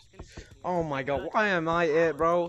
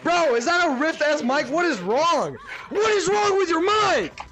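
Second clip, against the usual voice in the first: high, loud, escalating disbelief; incredulous yelling; 7 semitones higher, 4 dB louder than usual. Real, not acted. Complaining about someone's broken mic, incredulous yelling. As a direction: holler it, dragging out the complaint. Reacting to bad microphone.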